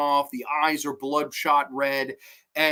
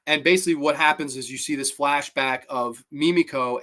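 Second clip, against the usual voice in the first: ordinary talk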